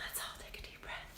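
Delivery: breathy whisper, barely voiced. Real, not acted. Host calming her live viewers after getting fired up, in a whisper. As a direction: whisper it to calm the room down.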